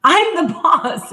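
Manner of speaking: high-pitched parental scolding, retold. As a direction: act out laying down the law to a bossy child. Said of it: "raising my voice and being like".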